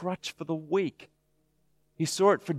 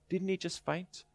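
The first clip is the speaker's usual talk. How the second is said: put-on child's question; 4 semitones higher, 7 dB quieter than usual. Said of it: "the year three kid saying".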